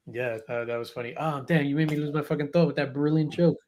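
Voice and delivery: ordinary talk, nothing marked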